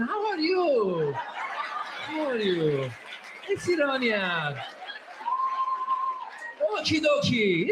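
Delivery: put-on lazily stretched, sing-song Iranian accent; slow Iranian talk. Comedian mimics sleepy Iranians making small talk, very slow. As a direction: drag the words out, half asleep. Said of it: "We talk very slow".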